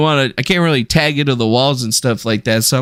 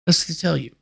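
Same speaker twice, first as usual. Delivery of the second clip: much softer muttered repeat; quiet; 7 dB quieter, faster than usual. Shown as a demonstration. Muttering the saying again to self, under breath.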